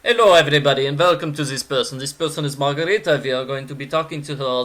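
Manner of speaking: attempted Italian accent sliding into German; slipping German accent. Streamer introducing Margarita to everybody, in an accidental German accent. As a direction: put on a bad German-sounding accent, briskly. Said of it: "slipping back into the German accent".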